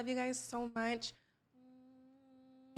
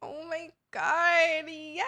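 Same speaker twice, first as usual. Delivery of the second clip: slow gushing; 4 semitones higher, 6 dB louder, much slower than usual. Pretend. Host overcome by emotion.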